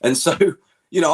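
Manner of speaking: ordinary delivery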